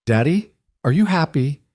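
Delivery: put-on high, drowsy child's voice; sleepy little girl. A narrator's reading. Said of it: "Emma asked sleepily".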